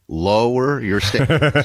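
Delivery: slow, laughing; laughing advice. Retold or imitated. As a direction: say it slowly through a laugh. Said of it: "that's what I keep telling them".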